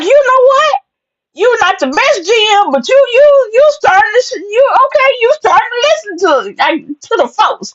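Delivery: high, sassy teasing scold; high-pitched sassy mock lecture. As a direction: talk down to him with attitude.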